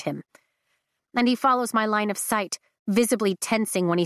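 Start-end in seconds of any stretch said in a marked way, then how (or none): none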